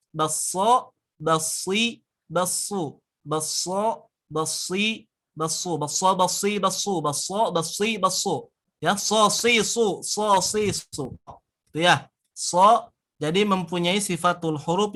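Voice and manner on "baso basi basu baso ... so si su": Baso, basi, basu with a light whistling s; whistling s demo. Shown as a demonstration. Teacher drilling the whistling sad with students. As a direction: let the s whistle a little, lips not too pursed. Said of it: "Tapi sedikit aja".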